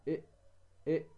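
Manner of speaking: إيه as short separate glottal catches; isolated hamza. Shown as a demonstration. Teacher demonstrating the throat letters, isolated hamza on إيه. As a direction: pop each إيه from the throat, with a gap between. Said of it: "الهمزة".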